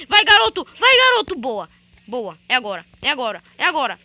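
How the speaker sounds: loud, punchy shouts of encouragement; rally him like a fan; loud cheering. Real, not acted. Gamer cheering loudly for the character to win.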